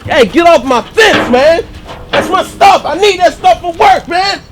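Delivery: loud, slow yell to get off; yelling at intruder. Acted. Friend yells at the father climbing his fence, loud. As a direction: shout him off your property.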